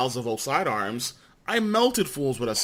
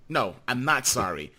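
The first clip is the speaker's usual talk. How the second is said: ordinary delivery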